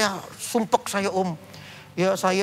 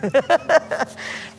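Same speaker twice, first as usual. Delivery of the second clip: laugh; 9 semitones higher than usual. Real, not acted. Laughs at off day.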